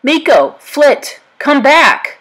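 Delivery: high, loud calls after the animals; calling out. A narrator's reading. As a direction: shout to stop them. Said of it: "cried Pocahontas".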